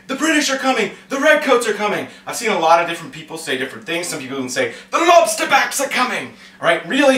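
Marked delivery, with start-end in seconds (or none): 0.1-2.1 high-pitched town-crier call, not full volume; mock warning yell. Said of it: "yelling and warning people". 4.9-6.3 high, hammed-up alarm call; mock warning yell. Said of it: "yelling and warning people".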